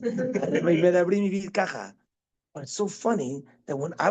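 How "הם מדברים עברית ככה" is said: quick imitation of Spanish-accented Hebrew. Describing Mexican classmates in yeshiva, imitated Spanish-accented Hebrew. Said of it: "כמו ספרדי".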